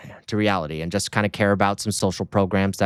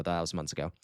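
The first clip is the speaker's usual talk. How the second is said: quiet repeat; 7 semitones lower, 12 dB quieter, faster than usual. Acted. Repeats the line much quieter.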